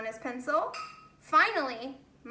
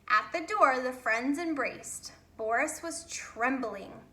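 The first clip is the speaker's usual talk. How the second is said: plain reading voice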